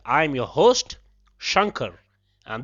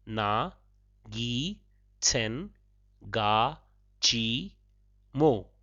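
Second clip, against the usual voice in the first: "Na, gi, tsen, ga, chi, mo" in separate softer syllables; broken into syllables; 4 semitones lower, 5 dB quieter, much slower than usual. Shown as a demonstration. Teacher splitting the phrase so tourists can copy it. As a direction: pause between each syllable. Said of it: "I shall break it up for you once again".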